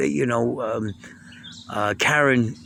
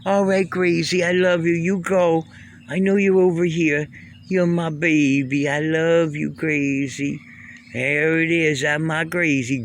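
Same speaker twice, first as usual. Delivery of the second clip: higher, sing-song cooing to a pet; 5 semitones higher than usual. Real, not acted. Talking to the cat Gracie.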